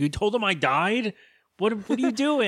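a brother stunned he was declared dead; high indignant disbelief. Retold or imitated. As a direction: squeak out betrayed disbelief. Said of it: "He's like".

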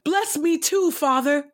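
a son begging his father through his grief; bitter wail. A narrator's reading. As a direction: Esau crying out to his father, understated anguish. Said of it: "he wailed loudly and bitterly".

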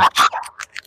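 breathy demonic scream-laugh; eerie unvoiced demon cackle. Real, not acted. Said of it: "That was demonic".

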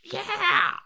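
very high, loud squealed cheer; Toad impression. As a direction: shriek one wild cartoon cheer. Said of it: "if that was how Toad sounded".

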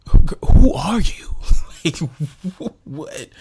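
high, loud, slow disbelief; who even are you, shocked; incredulous. Real, not acted. Reacting in disbelief to the customer missing dinner. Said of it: "incredulous outburst at customer".

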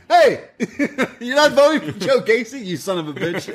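high-pitched mock confrontation; imitated angry yell. Host acting out someone confronting rival voters, mock yell. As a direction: call out in hammed-up outrage, breaking for a laugh. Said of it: "And then you hear someone".

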